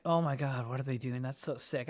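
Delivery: fading into a hushed awe; awed hush. Retold or imitated. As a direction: marvel under your breath. Awed at the DJs.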